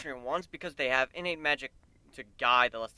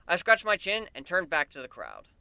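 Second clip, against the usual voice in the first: usual speaking voice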